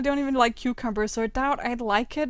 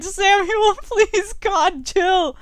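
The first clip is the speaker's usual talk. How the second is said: high, laughing plea to chill; 6 semitones higher, 5 dB louder, much slower than usual. Real, not acted. Gamer begging her character to calm down, laughing.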